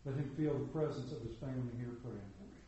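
soft, reverent prayer voice. Acted. Pastor praying over the young man leaving for school, soft and reverent. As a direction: lift him up to God gently.

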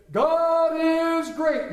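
hammed-up proclamation. Mock proclamation atop Eiffel Tower.

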